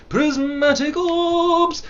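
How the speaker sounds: high, slow, loud cry; hammed-up drawn-out exclamation; mock proclamation. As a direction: call it out grandly, stretched. Mock announcing of orbs.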